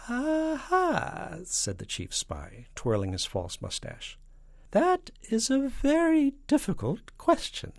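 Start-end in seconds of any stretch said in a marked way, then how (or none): 0.1-1.4 very high, drawn-out scheming spy; falsetto. A narrator's reading. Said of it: "and a falsetto voice". 4.7-7.7 very high, loud spy voice; falsetto. A narrator's reading. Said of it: "and a falsetto voice".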